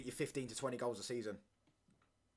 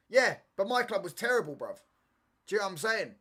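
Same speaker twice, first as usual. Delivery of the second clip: mock defensive retort; 6 semitones higher, 8 dB louder than usual. Acted retort to taunt.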